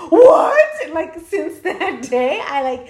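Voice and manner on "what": high, loud mock "what"; retold shock. Kids hearing that aliens are real, acted-out shock. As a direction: imitate a stunned yelp, pitched way up. Acting out their shocked reaction.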